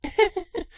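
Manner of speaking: high embarrassed little laugh; short bashful giggle; sheepish chuckle. Acted. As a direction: laugh it off awkwardly. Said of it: "sheepish chuckle".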